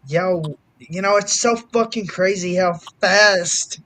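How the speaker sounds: ordinary talk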